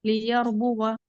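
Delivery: slow, drawn-out tajweed recitation; Quran recitation. Shown as a demonstration. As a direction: chant the verse slowly with care. Teacher recites Quran verse. (धीमी तजवीद वाली तिलावत)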